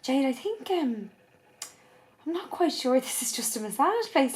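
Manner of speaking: a hint of a hesitant worried mum; retelling mum's uneasy warning; concerned mum. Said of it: "she looked really concerned and she was like".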